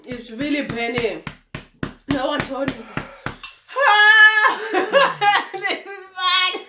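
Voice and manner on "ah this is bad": pained cry. Real, not acted. Pain from spicy chili.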